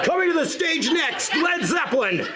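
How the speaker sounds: big booming rock concert announcer; hammed-up stadium announcer call. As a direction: announce the headliner to a roaring crowd. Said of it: "it sounded like a rock concert".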